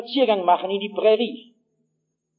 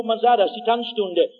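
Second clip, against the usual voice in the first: ordinary delivery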